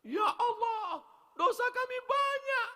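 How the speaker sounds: very high, loud wail; hammed-up sobbing plea to God; mock tearful zikir. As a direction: put on a weeping prayer to make the crowd cry. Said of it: "usahakan jamaahnya nangis".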